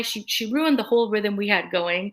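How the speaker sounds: ordinary talk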